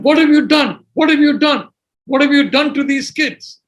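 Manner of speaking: high, insistent imitation of a scolding; berating. Upset sociologists confronting the speaker's friend, berating. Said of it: "all of whom started berating my friend".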